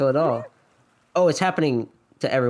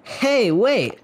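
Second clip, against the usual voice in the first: higher, urgent call; calling after someone; 8 semitones higher than usual. Acted. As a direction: call out to stop them. Character calls after someone leaving.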